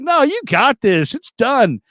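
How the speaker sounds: high, slow, drawn-out teasing; mock insistent taunt. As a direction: rub it in that it's over. Friend insists game is won.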